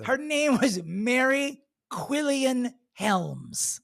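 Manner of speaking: each word of "Mary Quillian Helms" drawn out slow; slow dramatic reveal. Real, not acted. Telling her co-host the owner's name, slow dramatic reveal.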